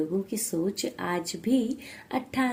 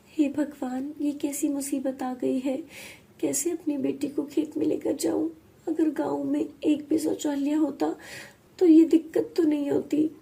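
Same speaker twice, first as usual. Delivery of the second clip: high-pitched helpless lament; distressed mother bemoaning her trouble; anguished mother; 5 semitones higher than usual. Acted. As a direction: wail to God in worry.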